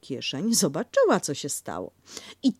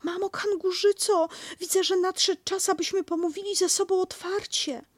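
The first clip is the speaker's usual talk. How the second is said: much higher squeaky piglet voice, mustering courage; little Piglet standing up for himself; bravely; 14 semitones higher than usual. Acted. Piglet to Kanga, much higher and brave. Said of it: "Powiedział więc z odwagą".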